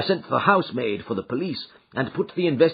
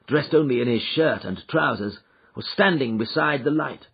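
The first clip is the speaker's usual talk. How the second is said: plain reading voice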